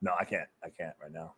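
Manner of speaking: turning down a friend's request, very quiet; sheepish mumble. Real, not acted. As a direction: trail away, embarrassed. Refusing to give money.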